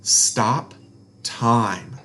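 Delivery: plain reading voice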